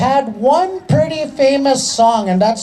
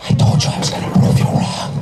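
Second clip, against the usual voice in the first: breathy retold whisper in her ear; a quick teasing whisper in the auntie's ear. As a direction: whisper it close and secret. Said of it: "whispered in her ear".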